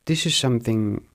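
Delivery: ordinary talk, nothing marked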